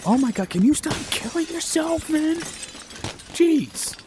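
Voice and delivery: high, exasperated yelp at a teammate; exasperated outcry. Real, not acted. Gamer yelling at a teammate who keeps dying, exasperated and high. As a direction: cry out in disbelief at the screen.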